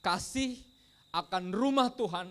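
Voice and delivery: high, forceful preacher's quote; impassioned verse. Acted. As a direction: proclaim the verse loudly, stretching the words. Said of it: "Ayatnya bilang gitu".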